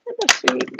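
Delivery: high, cooing greeting to the cat; pet talk. Real, not acted. Greeting her cat who just walked in, in high cooing pet talk. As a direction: coo hello to a pet.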